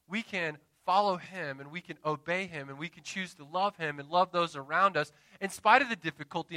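ordinary talk

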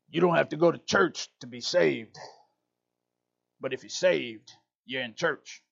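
light imitation of a rural Southern preacher accent; country preacher accent. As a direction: quote the old preacher in a mild country accent. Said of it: "it's my little country accent".

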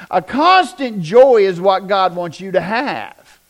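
ordinary talk

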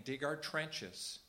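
slow, deliberate preaching; slow exhortation. Real, not acted. Preacher urging the congregation to persevere, slow and firm. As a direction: hammer each word home slowly. Sermon exhortation, repeated command.

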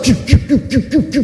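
rapid string of high "pew" sounds; imitated launching noises. Preacher showing people shooting up to heaven, high zipping noises. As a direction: make little rocket zips, one after another.

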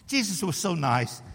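ordinary delivery

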